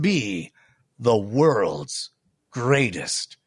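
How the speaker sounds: slow, gravelly movie trailer voice. Pretend. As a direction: growl it out like a trailer narrator. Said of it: "I'm working on the gravelly voice".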